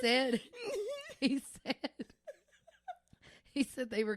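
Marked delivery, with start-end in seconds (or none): 0.0-2.0 high, loud, nearly crying with laughter; helpless laughter. Real, not acted. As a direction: struggle to speak through real laughter. Storyteller overcome by laughing.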